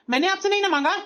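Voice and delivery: high-pitched, irritated retort; angry snapping. Acted. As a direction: tell the sister-in-law to stay out of it. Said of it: "गुस्सा क्यों कर रहा है". (गुस्से में ऊँची आवाज़)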